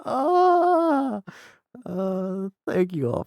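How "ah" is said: high loud frustrated wail; anguished cry. Real, not acted. Streamer crying out over a wasted playthrough. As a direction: wail in exasperation.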